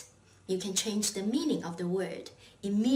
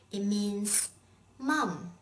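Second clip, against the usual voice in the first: ordinary talk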